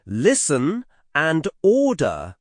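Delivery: normal talking voice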